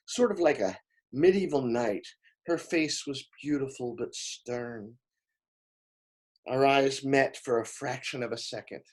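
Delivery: usual speaking voice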